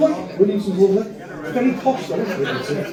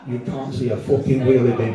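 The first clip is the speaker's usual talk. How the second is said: usual speaking voice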